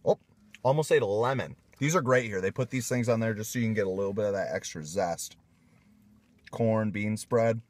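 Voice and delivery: words around a mouthful of food; speaking between bites; talking while chewing. Real, not acted. Seen 0: bearded man bites greens at +1.0, keeps eating throughout.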